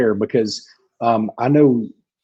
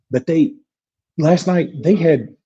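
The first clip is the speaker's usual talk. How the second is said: ordinary talk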